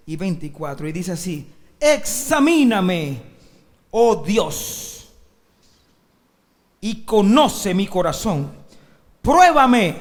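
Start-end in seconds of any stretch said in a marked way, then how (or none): 1.8-10.0 loud, slow, drawn-out psalm plea; declaimed scripture. Acted. Preacher reading a psalm to the congregation, declaiming. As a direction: proclaim each imperative forcefully, with pauses.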